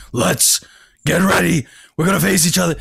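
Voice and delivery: imitating a pumped-up, forceful challenger's voice; Devin. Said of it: "The voice was like".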